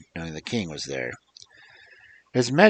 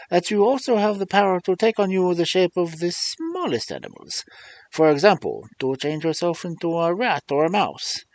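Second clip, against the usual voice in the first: put-on Puss with a light Spanish accent, higher; higher Spanish-accented cat voice; 7 semitones higher, 4 dB louder than usual. A narrator's reading. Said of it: "I had to do the accent for Puss".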